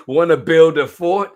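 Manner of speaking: loud, high, bouncy question; eager story invitation. A narrator's reading. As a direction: call it out like an excited playmate. Reads story question aloud.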